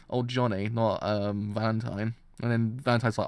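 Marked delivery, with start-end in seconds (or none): none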